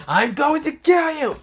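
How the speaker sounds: high, loud screeching threat; shrieking villain threat. Acted. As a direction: shriek it in rage. Frieza shouting again.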